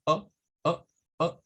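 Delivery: short, abruptly stopped "O"; cut-off O. Shown as a demonstration. Teacher contrasts English with Spanish O, clipped short "O". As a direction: cut the "O" short each time. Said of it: "Es un sonido como cortado".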